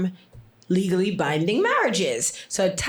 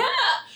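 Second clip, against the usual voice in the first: high sudden gasp; mock dying gasp. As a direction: yelp like a heart giving out. Bear heart stopping.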